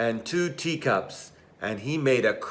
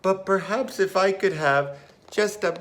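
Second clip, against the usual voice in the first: mouse voice; 6 semitones higher, faster than usual. Acted. Mouse voice asking sweetly.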